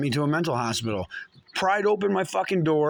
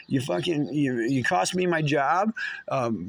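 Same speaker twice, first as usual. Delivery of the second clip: ordinary talk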